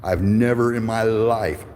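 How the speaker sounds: ordinary delivery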